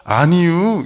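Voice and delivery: drawled Chungcheong "아니유"; Chungcheong dialect. Shown as a demonstration. Pastor demoing how the middle region says no, in Chungcheong dialect. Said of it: "어떻게 얘기합니까".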